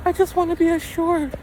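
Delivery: very high squeaky imitation of her pestering; high mocking girlfriend voice. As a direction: pitch way up as the nagging girlfriend. Mimicking girlfriend.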